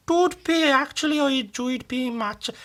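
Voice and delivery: high-pitched attempt at a Welsh accent; Irish impression slipping into sing-song Welsh. Shown as a demonstration. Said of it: "That was Welsh".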